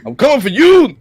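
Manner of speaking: high, loud wrestler yell; wrestling promo. Retold or imitated. Hulk Hogan promo impression.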